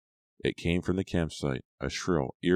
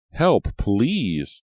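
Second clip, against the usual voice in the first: louder, higher cry for help; desperate plea; 7 semitones higher, 7 dB louder than usual. A narrator's reading. Maya begging the others for help, loud and high. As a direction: beg someone to come.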